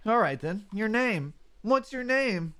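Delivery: gentle, lightly voiced young buddy; partner Mudkip voice. Mudkip partner telling about the Krabby bubbles, lightly voiced. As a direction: describe the sunset bubbles dreamily.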